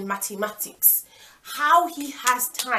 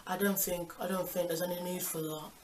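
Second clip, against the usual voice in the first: meek mumble; 3 semitones lower, 10 dB quieter than usual. Retold or imitated. Cheater meekly refuses.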